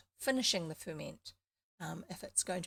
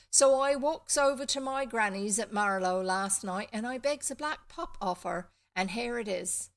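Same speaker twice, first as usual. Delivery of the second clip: put-on rural farm boy, lightly Somerset, raised voice; slight Somerset accent; 2 semitones higher, 8 dB louder than usual. Farm boy tells how he begged a pup, slight Somerset accent. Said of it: "I think it's slightly Somerset".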